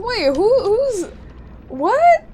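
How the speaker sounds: high, loud sudden confusion; startled. Real, not acted. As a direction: caught off guard by chat. Streamer startled by chat accusation.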